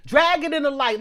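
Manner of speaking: loud, high-pitched declaration; emphatic outburst. Real, not acted. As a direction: belt it out emphatically. Emphatic repeat of her phrase.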